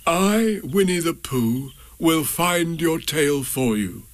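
earnest, slow solemn promise; a grand little vow. A narrator's reading. Pooh solemnly promises Eeyore to find his tail. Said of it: "he said solemnly".

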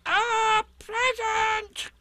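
very high, slow, loud squeak; Piglet shouting. A narrator's reading. Piglet repeats it for Eeyore's ear, very loud and high. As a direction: stretch both words in a tiny piercing shout. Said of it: "said Piglet very loudly".

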